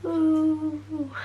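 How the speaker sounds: ordinary delivery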